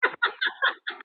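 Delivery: high, delighted cackle; real high laugh. Real, not acted. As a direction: burst into high-pitched laughter. Reacting to praise of impression.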